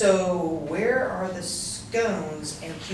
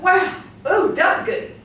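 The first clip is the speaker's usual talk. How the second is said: high, loud squealed goodies; giddy delight; 10 semitones higher, 6 dB louder than usual. Acted. Joan gushes over another generous committee check. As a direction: gush like a delighted child.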